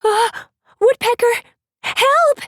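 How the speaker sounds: put-on a frightened girl shouting for help. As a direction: yell for help while fleeing. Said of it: "Cedar shouted".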